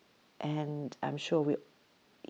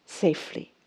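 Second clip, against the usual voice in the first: ordinary talk, nothing marked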